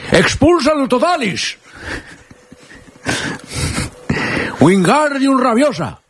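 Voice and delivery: high, drawn-out wizard incantation; hammed-up wizard's cry; mock spell-casting. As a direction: cast a silly spell with long, high vowels. Host casts mock Harry Potter spells.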